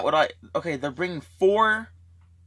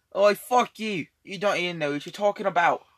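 higher, punchy British; indignant British mouthing-off; British accent; 3 semitones higher than usual. Shown as a demonstration. Showing a friend how to swear in British, higher British accent. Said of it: "but in British".

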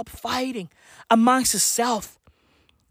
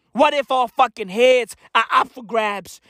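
ordinary talk

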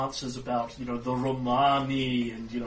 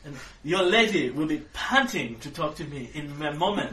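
offended pompous Frenchman; 4 semitones higher, 6 dB louder than usual. Acted. Offended Frenchman Gaston Lavache.

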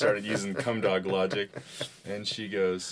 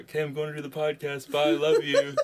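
high, breezy sign-off, louder at the end; breezy goodbye; 7 semitones higher, 5 dB louder than usual. Retold or imitated. Husband leaving his stunned wife for the podcast, breezy goodbye. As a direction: toss off a bright, oblivious farewell. Cheery exit after crude story.

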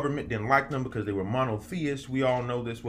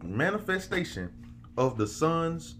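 slow, high, preacher cadence; preaching scripture; 4 semitones higher, slower than usual. Acted. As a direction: read it like a sermon from the pulpit. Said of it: "This man said".

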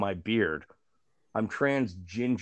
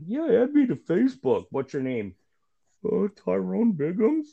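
higher, louder put-on voice for the exchange; mock voice; 9 semitones higher, 5 dB louder than usual. Trading Facebook names, pitched-up mock voice. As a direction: play both sides of the chat in a pitched-up mock voice.

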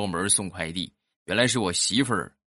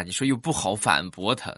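ordinary talk

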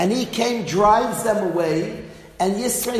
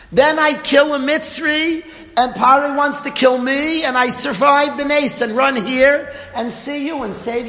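excited retelling; 5 semitones higher, 4 dB louder than usual. Retold or imitated. Daughters excitedly retell the story.

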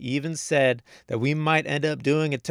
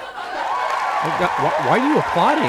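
louder, higher, cracking up mid-question; laughing outburst; 5 semitones higher, 7 dB louder than usual. Real, not acted. Host cracking up at the applauding audience. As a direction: laugh it out, disbelieving.

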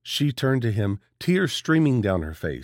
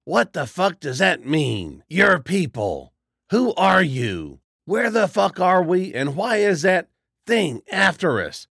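yelling; 7 semitones higher than usual. A narrator's reading. Charles yelling at the stranger for answers, building shout. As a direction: demand answers at the top of your voice. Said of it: "Charles was yelling now".

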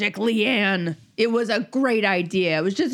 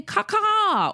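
playful crow call; 11 semitones higher than usual. Retold or imitated. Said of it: "You can just say".

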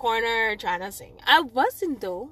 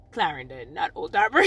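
normal talking voice

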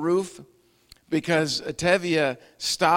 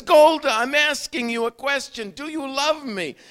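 raised, higher, pressing voice; insistent; 10 semitones higher, 7 dB louder than usual. Retold or imitated. Tevye pressing Golde for an answer, insistent raised voice. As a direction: demand an answer from your wife. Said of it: "Tevye says".

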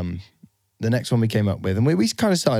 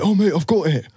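louder, higher imitation of a sudden brainwave; mock eureka; 5 semitones higher, 5 dB louder than usual. Host imitating co-host's late-night brainwave, mock excitement. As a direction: announce it like a late-night revelation. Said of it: "Then Barclay goes".

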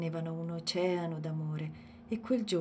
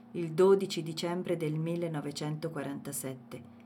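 ordinary delivery

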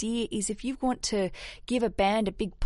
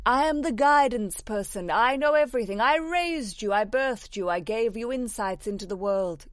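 bossy know-it-all parent; 2 semitones higher than usual. Retold or imitated. Host mocking a controlling mum to a listener, lightly hammed-up. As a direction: play the all-knowing parent lecturing her daughter. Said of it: "I'm sure your mum doesn't speak like that".

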